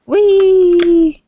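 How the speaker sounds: high, loud gleeful whee; excited squeal. Real, not acted. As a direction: squeal with delight.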